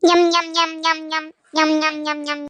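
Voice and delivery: low, fading chomp chomp sounds; munching noises. Acted. Sulking sister munching snacks after threatening to leave. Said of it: "மொங்கு மொங்குன்னு மொங்கிட்டு".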